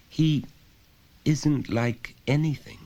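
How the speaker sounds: put-on a child answering simply; small boy. Son answering his father, lightly voiced child.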